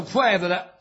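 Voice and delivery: ordinary delivery, nothing marked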